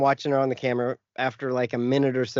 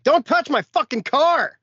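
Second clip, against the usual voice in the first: retold yell; 13 semitones higher, 4 dB louder than usual. Yelling at intruder over car.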